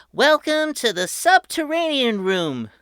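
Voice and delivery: put-on high, loud tour-guide kid; proud boy host. A narrator's reading. Patrick welcoming his family underground, high and proud. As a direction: announce it like a grand showman. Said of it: "Patrick said".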